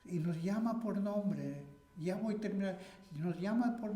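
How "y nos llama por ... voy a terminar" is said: usual speaking voice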